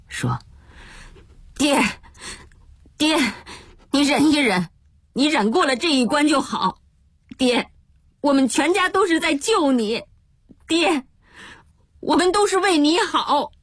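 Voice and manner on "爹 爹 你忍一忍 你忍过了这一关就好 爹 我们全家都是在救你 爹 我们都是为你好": high-pitched, halting plea through heavy breath; panting. Acted. Eldest son urging father to endure, panting and distressed. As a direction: plead breathlessly, in short phrases. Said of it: "嘉禾大声地喘息起来".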